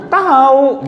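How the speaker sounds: high, loud snap of "Tahu"; blunt Betawi reply. Retold or imitated. The ojek driver repeating his answer, high and loud. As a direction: bark "Tahu" back as a brush-off.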